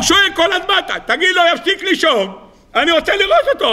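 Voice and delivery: high-pitched, mock-exasperated protest; insistent demand. Retelling the emperor's demand to see the lion, mock-insistent. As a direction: act out a demand, pitched well up.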